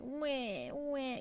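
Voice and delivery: whining imitation of a demanding cat; drawn-out cat whine; hungry, bored cat. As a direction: wail like an ignored cat wanting attention. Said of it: "when he's hungry or bored".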